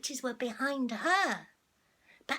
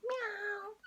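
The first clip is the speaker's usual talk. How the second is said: meow; 8 semitones higher, 7 dB quieter than usual. Acted. Cat in the story meows.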